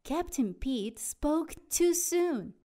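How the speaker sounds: plain reading voice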